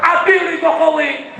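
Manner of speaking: high, drawn-out rally cry; imitating a protest chant at full voice; protest shout. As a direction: shout it like a street demonstrator's slogan. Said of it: "Saya di mana-mana teriak".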